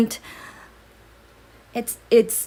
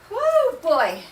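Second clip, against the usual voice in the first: puffing after running around; winded, catching breath; 6 semitones higher than usual. Real, not acted. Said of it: "out of breath".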